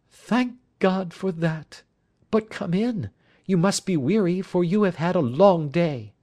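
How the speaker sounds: high-pitched lady's voice, relief then welcome; anxious wife, relieved. Acted. Wife welcomes the weary men in, high relieved voice. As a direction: voice a worried wife well above your own pitch.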